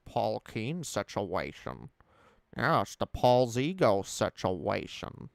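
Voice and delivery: slow, lightly done impression of a copied accent; a hint of Paul's Brett Keane voice. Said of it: "an impression of Paul's impression".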